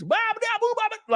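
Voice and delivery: very high Southern-movie gibberish; mock Hollywood Southern. Streamer imitating Hollywood movie Southerners, exaggerated Southern babble. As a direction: babble in a squeaky parody Southern accent. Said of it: "But in Hollywood movies".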